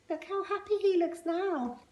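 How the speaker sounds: high happy aside; high-pitched gleeful remark; delighted aside. Real, not acted. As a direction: share the joy.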